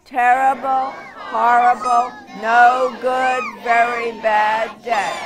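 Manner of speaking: building to a loud group scream; screamed refrain. Acted. Reader leading children in the refrain, screaming. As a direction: shout the refrain with the kids.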